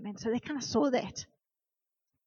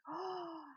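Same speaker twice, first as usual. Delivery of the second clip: high, soft gasp of "oh"; acted dismayed gasp; 6 semitones higher, 7 dB quieter than usual. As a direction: re-enact the moment of noticing a loss. Acts discovering the lost bracelet.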